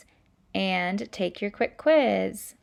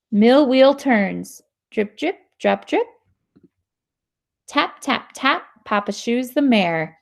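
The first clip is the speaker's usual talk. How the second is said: put-on louder, lively read-aloud voice for kids; reading to children; 8 dB louder than usual. Teacher reading a sound-word book to students, lively. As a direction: bring the story alive for the class.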